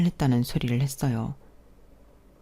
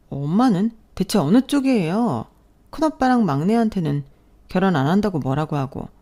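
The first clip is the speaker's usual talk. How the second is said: high, indignant complaint; protesting daughter; 4 semitones higher than usual. Acted. Daughter to mother over her double standard, protesting. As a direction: argue back in a raised, aggrieved pitch.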